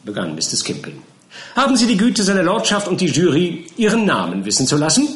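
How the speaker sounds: high, loud mock-polite question; mocking counsel. A narrator's reading. Counsel Skimpin begins grilling Winkle, high and mock-polite. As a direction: open with exaggerated courtesy.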